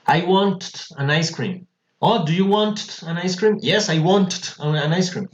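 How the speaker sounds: WANT with a stuttered extra T each time; absurd doubled T. Shown as a demonstration. Teacher mocks the impossible ending in a dialogue, WANT ending "t-t". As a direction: act out a silly exchange, WANT said "want-t". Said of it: "Eso suena súper loco, parecemos un pájaro haciendo ruido".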